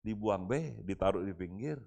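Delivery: normal talking voice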